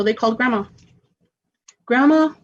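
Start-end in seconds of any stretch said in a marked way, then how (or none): 1.8-2.3 calling out. Retold or imitated. Mom phoning Grandma to ask, calling out. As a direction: call out Grandma, higher and louder.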